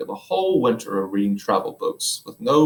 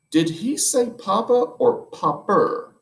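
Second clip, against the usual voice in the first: astonished; 11 semitones higher, slower than usual. Acted. Astonished wife.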